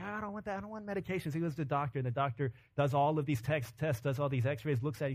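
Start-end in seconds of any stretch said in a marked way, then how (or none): 0.0-1.3 soft, higher-pitched protest; hushed comic whine; mock whiny refusal. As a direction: act out a reluctant patient, small and high. Patient refuses the psychiatrist's offer.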